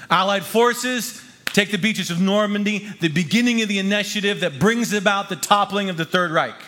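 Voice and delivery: high, emphatic historical proclamation; rousing preaching. Real, not acted. Preacher telling history buffs about D-Day, rousing proclamation. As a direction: declare the turning point with driving force.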